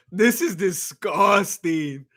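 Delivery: drawn out, slowly; slow disgust. Real, not acted. Streamer reacting to a robbery decision, slow disgust. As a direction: drag each word out in disgust.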